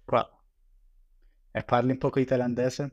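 ordinary talk, nothing marked

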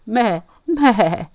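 two loud, high goat bleats; imitated goat's meh. Goat bleats after answering.

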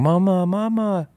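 put-on high, slow calling out like a frightened toddler; imitating her little son crying for mama; scared child calling. I think he's calling.

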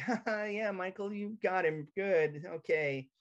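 high, fake chuckling agreement; mock the relatives cackling along; family laughing along. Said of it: "the whole family's like".

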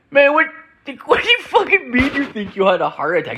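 can't believe what you just heard; shocked disbelief. Retold or imitated. Disbelieving reply to coworker.

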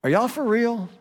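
high, astonished question; incredulous. Retold or imitated. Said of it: "stopped one of our volunteers and said".

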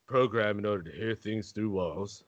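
a calm, low, knowing narrator; Morgan Freeman impression. Friend asked to do his best Morgan Freeman in a deep voice. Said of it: "you do Morgan Freeman".

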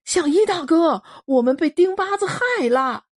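高声呼喊求援; 提高嗓门急切呼喊; 囚徒们喊. Acted. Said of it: "囚徒们喊". (囚徒们高声呼喊)

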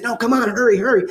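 high, urgent hurry-up. Retold or imitated. Father urging his son to hurry from the bear, high and urgent. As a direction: rush him along in a panic. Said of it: "I said".

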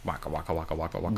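low, soft waka-waka gibberish; mock penguin chatter. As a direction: chatter quietly like a cartoon bird. Joins in the Penguin noises.

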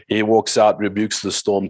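usual speaking voice

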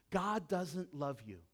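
ordinary delivery

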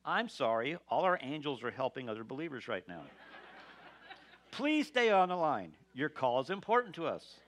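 recorded on-hold voice, half laughing; phone menu voice. Retold or imitated. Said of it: "this familiar excuse that we often hear when we call".

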